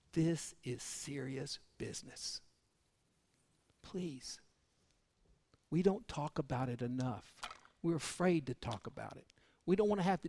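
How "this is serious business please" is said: whispered, slow and soft; urgent whisper to the congregation; hushed plea. Real, not acted. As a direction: lean in and plead quietly.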